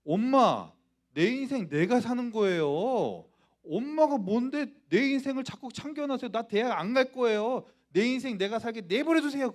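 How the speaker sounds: acting out a teenage son's loud, high outburst; defiant talking back. Son tells mother to stop meddling, defiantly. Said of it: "그러면서 엄마에 대들었어요".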